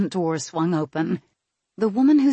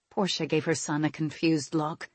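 ordinary delivery, nothing marked

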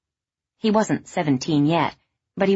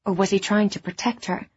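ordinary delivery

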